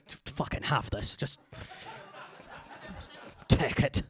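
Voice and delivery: half-voiced Scottish threat, quiet and halting; scary Scottish accent. Scary Scot talking dirty, mock menacing Scottish accent. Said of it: "Scottish, just scary, innit?".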